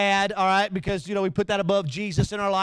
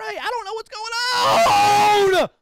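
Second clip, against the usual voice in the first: high loud slow yell; mock overwhelmed shout; 13 semitones higher, 8 dB louder, much slower than usual. Praying in a screaming crowd, loud and confused. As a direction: shout over the noise, lost.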